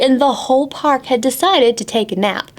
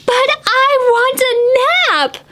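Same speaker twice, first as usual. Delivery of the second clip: mock shout; 13 semitones higher, slower than usual. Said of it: "shouted Annalise Devon McFleece".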